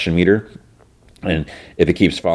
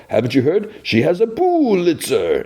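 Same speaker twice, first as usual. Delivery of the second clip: mocking sarcasm; 11 semitones higher than usual. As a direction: stretch it out to mock her. Clayface mocking Lois's Pulitzer.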